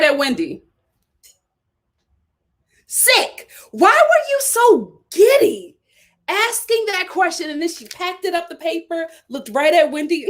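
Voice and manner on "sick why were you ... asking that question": outraged disbelief. Real, not acted. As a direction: throw it up high in disgust. Outraged rhetorical outburst.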